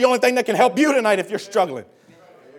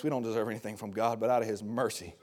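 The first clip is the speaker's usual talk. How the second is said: ordinary delivery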